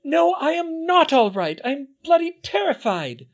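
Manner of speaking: high, loud frightened cry; yelling. A narrator's reading. Hermione yells at the twins that she is terrified, loud and high. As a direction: shout out your terror.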